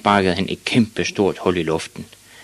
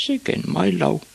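put-on high-pitched boy's grumble; grumbling boy; 12 semitones higher, slower than usual. A narrator's reading. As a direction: complain privately in a small high voice.

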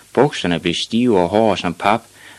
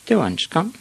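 put-on high-pitched child's voice; high, let-down boy; disappointed small boy; 10 semitones higher than usual. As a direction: lament it like a little pupil. Disappointed Ivan to teacher.

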